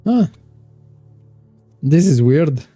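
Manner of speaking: normal talking voice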